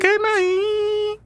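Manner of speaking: squeaky falsetto goodbye; very high squeaky goodnight. Acted. Husband echoing his wife's goodnight in falsetto. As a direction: squeak it back at her.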